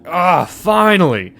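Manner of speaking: loud, high, drawn-out cry; exultant relief. Acted. Patient seeing the sports network come on, exultant. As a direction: burst out as if long deprived.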